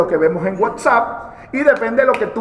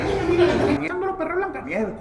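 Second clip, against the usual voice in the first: squeaky dwarf; 8 semitones higher, 6 dB quieter than usual. Acted. Dwarf repeats, higher voice.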